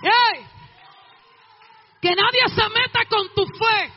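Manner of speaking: normal talking voice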